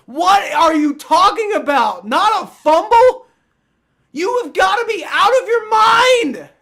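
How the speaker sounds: high, loud disbelieving yell; furious shout. Real, not acted. Streamer screaming at refs who ruled no fumble, furious shout. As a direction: shriek it like the call is insane.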